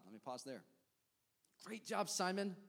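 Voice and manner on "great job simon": high, bright cheer for Simon; enthusiastic praise. Real, not acted. Preacher praising Simon Peter, high excited cheer. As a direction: cheer him on like a proud coach.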